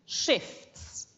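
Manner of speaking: Shifts said with a breathy voiceless edge; whispered model of Shifts; a clear, unvoiced Shifts; model word. Shown as a demonstration. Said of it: "it just goes on the E".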